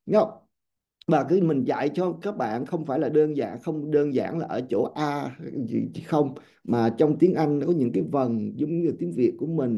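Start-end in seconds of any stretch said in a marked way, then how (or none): none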